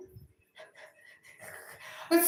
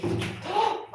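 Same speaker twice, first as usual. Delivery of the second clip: startled high gasp; sudden alarmed intake of breath; sharp gasp; 9 semitones higher, 3 dB quieter than usual. Real, not acted. Cat about to fall.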